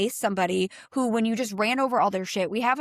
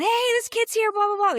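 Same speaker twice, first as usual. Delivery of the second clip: high yelled warning at a speeding driver; retold screaming; 13 semitones higher, 5 dB louder than usual. Yelling at the driver to mind the kids. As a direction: shout it way up high. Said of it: "we're screaming".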